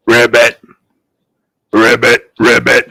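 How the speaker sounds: low frog croak. Acted. Someone turned into a frog.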